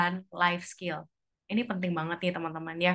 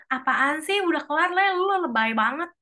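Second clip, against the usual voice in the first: annoyed and dismissive, pitched up; kesal meremehkan; 6 semitones higher, 3 dB louder than usual. Acted. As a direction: brush off the partner with impatience. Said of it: "Terus kita bilang". (nada kesal dan meremehkan)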